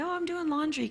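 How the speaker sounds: light, high, falsely cheerful; fake breezy answer. Mother fibbing to husband on phone, mock cheerful. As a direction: cover up with a carefree lilt.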